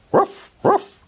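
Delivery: high yappy puppy bark; dog barking. A narrator's reading. Patch barking at something he spots, high yappy bark. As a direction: bark like a small excited dog. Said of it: "barked Patch".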